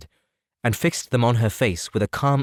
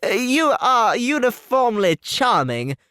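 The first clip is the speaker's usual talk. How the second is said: higher, slow, fumbling pompous suitor; a stiff clergyman playing the gallant, slow and higher; awkward gallantry; 13 semitones higher, 3 dB louder, slower than usual. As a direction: flatter her clumsily and self-importantly. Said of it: "cried he with an air of awkward gallantry".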